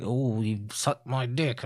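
slow, low mock-sleazy voice. Co-host imitates guy in van, mock sleazy. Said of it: "it's all sleaze".

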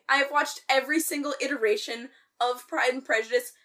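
ordinary talk

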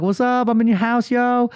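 high, brash little intruder; imitated daughter. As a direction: announce it like a cocky kid.

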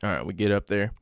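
Southern father teaching his son; Southern accent. Acted. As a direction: instruct like a country dad with a Southern accent. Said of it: "Southern accents?".

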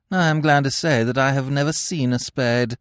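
satirical. Acted. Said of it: "said Gwendolen satirically".

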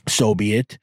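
ordinary talk, nothing marked

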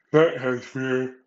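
stretched out mid-yawn, slow. Real, not acted. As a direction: say it through a big yawn. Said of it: "excuse the yawning".

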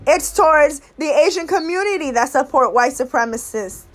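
ordinary talk

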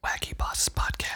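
breathy whispered title; whispering. Pretend. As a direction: whisper the show name.

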